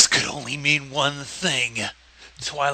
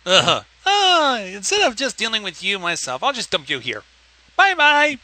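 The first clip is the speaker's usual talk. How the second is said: mock princess; 7 semitones higher, 3 dB louder than usual. Mocking princess voice.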